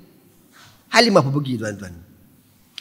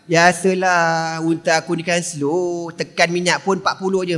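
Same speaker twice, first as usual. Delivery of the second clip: slow, dragging resigned voice; slow weary reply; 3 semitones lower, 4 dB quieter than usual. Retold or imitated. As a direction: sigh it out slowly. Limah replies slowly.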